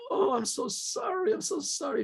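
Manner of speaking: sobbing apology. Retold or imitated. Choked sorry that can barely get out. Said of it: "He just couldn't speak".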